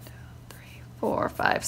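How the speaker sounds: counting under the breath; whispered counting. Shown as a demonstration. Showing how she counts out blanks, whispered. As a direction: whisper the numbers off one at a time.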